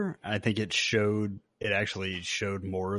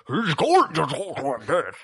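high, swooping masked-villain voice; garbled, hammed-up villain impression, voice lurching up and down; Bane impression; 5 semitones higher, 3 dB louder than usual. Host showing how the actor talks under the symbiote, hammed-up villain impression. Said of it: "that's my Bane impression".

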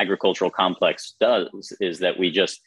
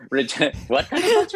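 squeaky high parroting through a laugh; teasing, giggling imitation of the puzzled question; laughing mock echo. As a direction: laugh and throw their question back up high.

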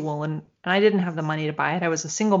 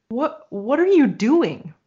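retold aghast; 4 semitones higher, 5 dB louder, slower than usual. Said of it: "completely aghast at what I was seeing".

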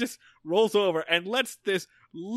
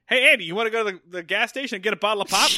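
rushed eager invite; 4 semitones higher, 4 dB louder, much faster than usual. Retold or imitated. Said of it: "he runs in".

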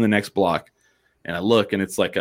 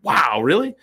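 higher, impressed rise; surprised wow; 7 semitones higher than usual. Retold or imitated. Bartender hears he lives four blocks away, surprised. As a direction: sound genuinely impressed. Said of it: "And she was like".

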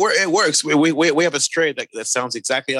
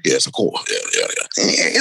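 put-on posh British accent. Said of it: "the Americans think".

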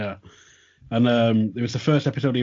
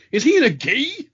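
quoted remark; 13 semitones higher, 7 dB louder than usual. Retold or imitated. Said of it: "just remarks".

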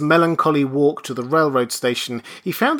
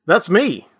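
blurted, higher and louder; bursting out; 7 semitones higher, 6 dB louder than usual. A narrator's reading. Benjamin blurts to the registrar, eager. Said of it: "burst out Benjamin".

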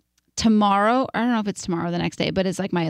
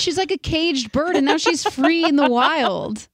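hammed-up poetic swoon, pitched up; mock-dramatic gushing; 6 semitones higher than usual. Gushing over her newfound freedom.

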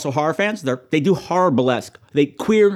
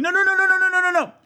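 very high, rapid-fire string of no's; frantic horror-viewer protest; faster than usual. Acted. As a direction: yelp like you're warning someone on screen. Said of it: "I'd be going".

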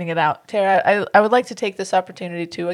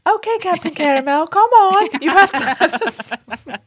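exaggerated British little-girl voice, much higher and louder; Peppa Pig; 9 semitones higher, 5 dB louder, slower than usual. Redoing the listener's letter, high British little-girl impression. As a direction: pipe it up like an English cartoon child. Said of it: "That's what I should have done".

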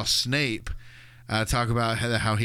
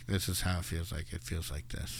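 quiet heartbroken murmur; 4 semitones lower, 10 dB quieter than usual. Retold or imitated. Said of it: "Harry's like".

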